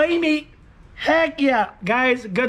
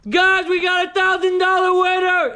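high, loud, stretched-out yells of joy; ecstatic shouting; 8 semitones higher, 3 dB louder than usual. Real, not acted. Scratching a winning ticket, yelling to viewers in ecstatic joy. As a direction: scream it like you just won big.

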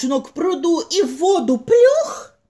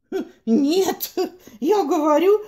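high, laughing rooster voice; cocky rooster, laughing; 6 semitones higher than usual. Acted. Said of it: "петух захлопал крыльями и закричал".

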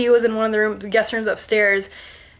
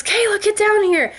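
high, urgent mock call down the stairs; 11 semitones higher than usual. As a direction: yell for her daughter to come now. Mom calling upstairs in panic.

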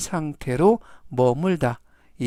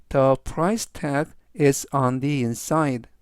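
very slow, each word separated; slow, clear reading; much slower than usual. Shown as a demonstration. Teacher slowly reads the sentence three times for students. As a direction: read slowly so students can follow. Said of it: "제가 역시 천천히 세 번씩 읽어드리고요".

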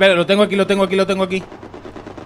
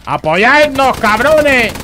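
shouting for support; 7 semitones higher, 4 dB louder, much slower than usual. Real, not acted. Yelling at teammates for support.

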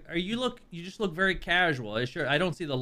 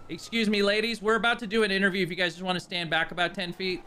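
raised voice to the crowd; calling out to bystanders; 6 semitones higher than usual. Real, not acted. Host shoos ladies away loudly.

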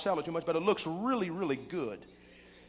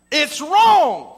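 sharp raised cry of protest, high and loud; loud complaint; 6 semitones higher, 9 dB louder than usual. Retold or imitated. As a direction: call it out loud like a scandal. Guest repeats the complaint.

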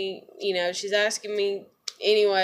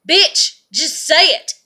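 loud, higher snapped retort; angry thought; 5 semitones higher, 8 dB louder, slower than usual. Retold or imitated. Voicing her inner retort to the friend, angry. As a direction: spit it out at the friend. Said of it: "that's what goes on my, in my head".

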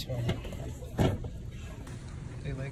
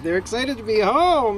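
high, loud, delighted announcement; excited gushing; 9 semitones higher, 5 dB louder than usual. Real, not acted. Welcoming tadpoles home, excited.

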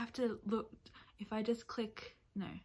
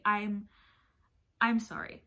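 ordinary talk, nothing marked

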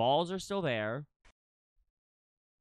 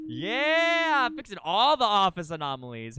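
triumphant cheer; 3 semitones higher, 7 dB louder than usual. Real, not acted. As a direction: cheer it proudly.

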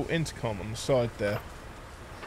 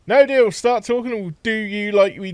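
loud refusal; 8 semitones higher, 6 dB louder than usual. Real, not acted. Player rejects deal.

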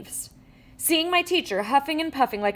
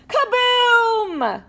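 high, loud shouted kaboom; big kaboom shout; 12 semitones higher, 6 dB louder than usual. A narrator's reading. Tow truck exploding, loud high kaboom. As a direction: blast it out like an explosion.

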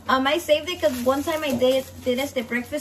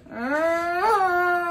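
long drawn-out rising cat moan; imitated cat yowl; 4 semitones higher, 3 dB louder than usual. Storyteller imitating the orange cat, long yowling moan. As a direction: yowl like a tomcat, stretched out.

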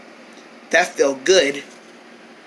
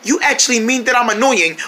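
usual speaking voice

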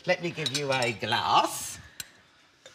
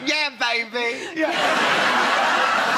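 put-on slow, higher "Yeah, baby." with a laugh; Austin Powers; 8 semitones higher, 5 dB louder, much slower than usual. Host answering the Austin Powers comparison, playful impression. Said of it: "remind me a little bit of Austin Powers".

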